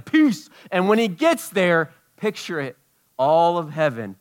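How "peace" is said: high, loud farewell; Jesus departing. Retold or imitated. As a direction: call it out rising away. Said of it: "floats up to heaven".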